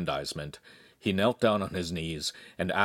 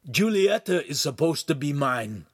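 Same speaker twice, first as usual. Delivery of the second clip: higher-pitched, put-out complaint; jealous protest; 7 semitones higher, 3 dB louder than usual. A narrator's reading. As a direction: stake a claim with wounded pride.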